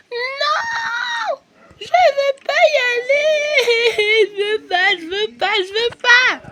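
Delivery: very high slow sobbing wail; crying toddler. Pretend. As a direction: howl it like a toddler refusing school. Toddler Sophie crying tantrum.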